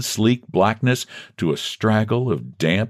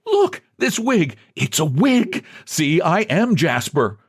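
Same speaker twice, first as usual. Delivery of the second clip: an eager, slower, higher plea; urging; 9 semitones higher, slower than usual. A narrator's reading. A man begging to be recognised, urgent and eager. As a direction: press him to see it, urgently. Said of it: "as John urged".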